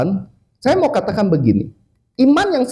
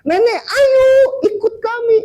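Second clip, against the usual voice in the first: high-pitched rescuer calling across; urgent calling out. Retold or imitated. Rescue team to grandmother on the roof, urgent high call. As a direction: act out a rescuer hailing granny.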